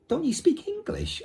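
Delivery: put-on a puzzled boy asking high. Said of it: "asked Omri".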